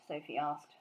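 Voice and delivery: usual speaking voice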